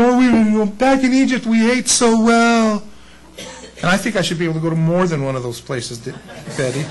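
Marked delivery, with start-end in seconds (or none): 0.0-2.8 a lightly whining complaint, the last words drawn out slow; mock whining. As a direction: whine like a spoiled crowd longing for Egypt. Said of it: "they were whining".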